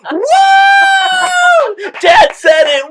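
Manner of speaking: put-on high, loud gleeful kid cheer; cheering shout. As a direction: yell it like you caught him. Kids cheering dad's bad word.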